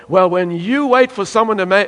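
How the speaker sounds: ordinary delivery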